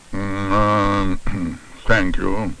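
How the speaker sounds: halting, humming dentist; hesitate, then accept politely; lightly voiced wary dentist; hesitant dentist. A narrator's reading. Dentist takes toffee.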